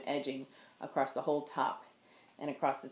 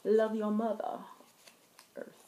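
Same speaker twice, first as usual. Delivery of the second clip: put-on light [name]-style accent, a touch higher; 4 semitones higher than usual. Vlogger jokingly says yarn name in [name] accent. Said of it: "You say it with a share accent".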